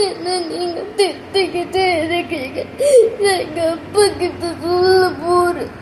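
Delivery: slow, hammed-up crying with a laugh breaking through; fake crying. Student pretending to cry at the teacher, hammed-up sobbing. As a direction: fake a sob to scare the teacher. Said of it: "இல்ல, acting தான்".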